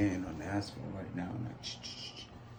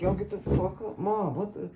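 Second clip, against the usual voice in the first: higher, startled protest; startled yelling at mom; 11 semitones higher than usual. Retold or imitated. Waking up to mom hitting his arm, high startled protest. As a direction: yelp at your mom, pitched up in shock. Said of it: "And I'm like".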